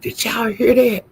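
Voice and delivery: high-pitched incredulous question; scandalized disbelief. Real, not acted. Gossiper checking that viewers heard it, scandalized. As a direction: ask it up high, aghast at the gossip.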